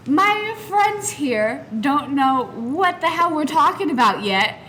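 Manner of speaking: ordinary talk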